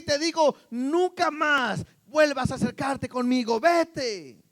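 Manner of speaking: high, loud, furious accusation; angry denunciation. Acted. Imagined Jesus casting out Peter the liar, shouting angrily. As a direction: shout the betrayal out, stretching key words.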